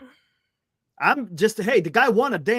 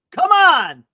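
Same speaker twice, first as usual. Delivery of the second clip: loud, high cry of protest; exasperated outburst; 7 semitones higher, 9 dB louder than usual. Real, not acted. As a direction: burst out at the doubters. Exasperated with worried fans.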